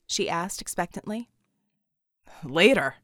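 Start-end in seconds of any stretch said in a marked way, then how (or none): 2.4-3.0 grunted. Acted. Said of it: "he grunted".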